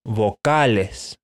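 Vocales: vo-KA-les, Spanish o and open a, said slowly. Shown as a demonstration. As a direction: say Vocales the Spanish way, slow and clear. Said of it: "are actually called vocales".

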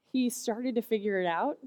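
normal talking voice

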